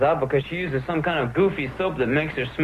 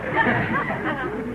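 short amused chuckle; amused laugh; 9 semitones higher than usual. Real, not acted. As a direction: laugh at his brag. Brother laughing at boasting.

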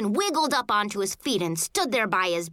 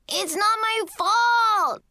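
put-on high, long-drawn kid's shout; shouted protest; 11 semitones higher, 4 dB louder, much slower than usual. A narrator's reading. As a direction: shout it higher and stretch it into a wail. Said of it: "Kevin shouted again".

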